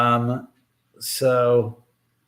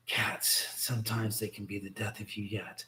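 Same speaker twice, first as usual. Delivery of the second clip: softer, breathy whisper; whispered aside; 3 semitones lower, 13 dB quieter than usual. Real, not acted. Owner whispers an aside about the cats, hushed. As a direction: mutter it under your breath to yourself.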